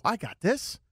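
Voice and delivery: keyed-up, sure-of-yourself inner voice; retold confident self-talk. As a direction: act out cocky in-the-moment thinking.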